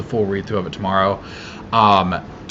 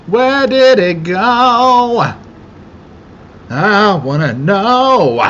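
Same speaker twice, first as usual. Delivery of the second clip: high, loud, slow sing-song whine; silly high voice; 11 semitones higher, 7 dB louder, much slower than usual. Acted. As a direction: stretch it up high like a cartoon. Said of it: "repeated in a different voice".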